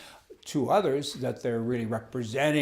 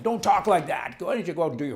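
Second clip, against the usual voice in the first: higher, louder rebuke; indignant scold; 5 semitones higher, 5 dB louder than usual. Real, not acted. Speaking to the senator, an indignant louder scold. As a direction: scold her for whining.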